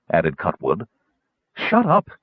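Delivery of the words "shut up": snapped. A narrator's reading. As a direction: Shut up snapped high and sharp. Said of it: "Kitiara snapped".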